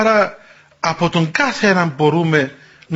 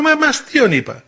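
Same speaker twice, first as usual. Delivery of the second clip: defensive joker; 7 semitones higher than usual. Retold or imitated. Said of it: "να λέω".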